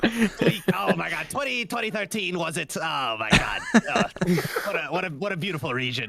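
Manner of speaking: hammed-up, overcome character voice; theatrical put-on wistfulness, bursts of loudness; nostalgic mascot character. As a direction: reminisce grandly like a cartoon star back home. Mascot reminisces about homeland, in character.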